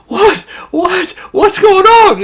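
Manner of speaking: high, loud frightened yelps; scared. Retold or imitated. Sam answering Russell in a terrified high shout. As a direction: blurt it out in sheer fright. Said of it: "he's all scared".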